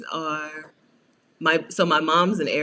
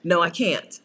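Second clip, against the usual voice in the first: usual speaking voice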